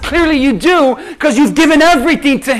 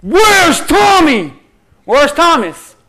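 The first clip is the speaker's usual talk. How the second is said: calling out; 4 semitones higher, 6 dB louder, slower than usual. Acted. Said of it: "the first thing he says is".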